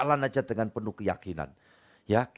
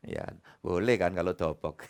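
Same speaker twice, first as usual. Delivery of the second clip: chuckling through the words; laughing; 6 semitones lower than usual. Real, not acted. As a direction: say it while laughing at the joke. Laughs at own joke word.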